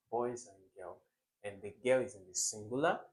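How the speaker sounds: ordinary talk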